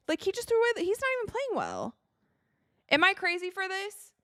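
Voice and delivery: high, disbelieving complaint to chat; can't believe what she's watching; high-pitched exasperation; exasperated high rant. Real, not acted.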